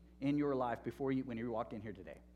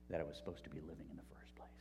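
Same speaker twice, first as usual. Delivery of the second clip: breathless whispered reveal of wisdom; hushed whisper; 7 semitones lower, 8 dB quieter, faster than usual. Retold or imitated. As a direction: whisper the answer quickly, almost secret. Quoting the man's calm answer.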